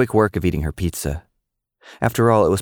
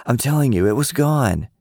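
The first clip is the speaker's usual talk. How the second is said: protesting; 7 semitones higher than usual. A narrator's reading. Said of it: "Lily protested".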